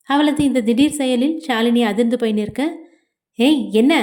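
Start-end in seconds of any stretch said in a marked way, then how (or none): none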